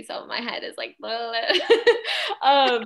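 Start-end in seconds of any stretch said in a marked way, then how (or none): 1.0-2.8 tipsy giggle into um; giggling after a drink. Real, not acted. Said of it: "sorry, I'm a little tipsy".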